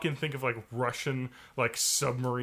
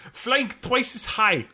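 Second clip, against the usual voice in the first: higher mock Russian accent; 6 semitones higher than usual. Friend mocking the show, mock Russian accent. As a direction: lecture it like a cartoon commissar. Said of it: "appreciate my accent".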